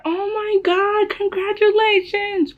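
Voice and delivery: very high, drawn-out squeal of joy; imitated excitement. Daughter mimics mom celebrating the pregnancy, high excited squeal. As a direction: stretch out mom's celebration, way up high.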